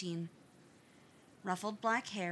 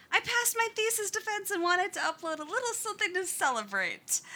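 excited; 12 semitones higher, 6 dB louder than usual. A narrator's reading. Author notes, excited.

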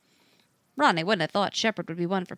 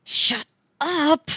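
hissed through gritted teeth; through clenched teeth; 8 semitones higher, 5 dB louder than usual. Acted. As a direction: bite the words out with a locked jaw. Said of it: "through clenched teeth".

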